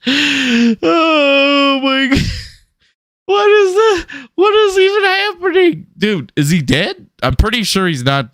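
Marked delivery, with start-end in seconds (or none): 0.1-5.9 high, drawn-out bewilderment; bewildered. Real, not acted. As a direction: cry out in confusion. Bewildered exclamation.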